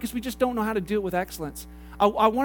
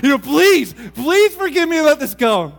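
desperate pleading; 8 dB louder than usual. Retold or imitated. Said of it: "And I'm like".

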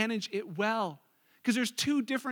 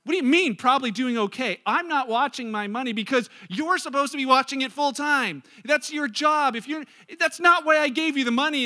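loud, raised-pitch acted outrage; indignant client; 3 semitones higher, 6 dB louder than usual. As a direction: scold the careless planner loudly. Acted angry client to planner.

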